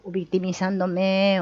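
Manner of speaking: ordinary delivery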